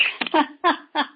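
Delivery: high, loud burst of laughter; laughing. Real, not acted. Healer laughs after telling of the walk, delighted laugh.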